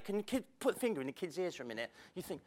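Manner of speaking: ordinary talk, nothing marked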